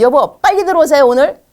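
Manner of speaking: loud, high-pitched nagging call; demanding wife. Acted. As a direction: act out a wife pressing her husband to hurry. Wife demanding husband come home.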